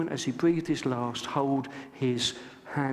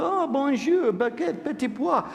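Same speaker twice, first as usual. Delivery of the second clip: hammed-up French accent, high and sing-song; mock French; 10 semitones higher than usual. English guest mocking French swimmers, hammed-up French accent. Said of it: "all the French stride in to the water".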